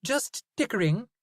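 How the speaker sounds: Zoe, haggling with the butcher; Zoe's voice. A narrator's reading. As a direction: brush it off as Zoe. Zoe character voice.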